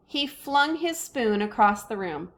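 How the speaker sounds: usual speaking voice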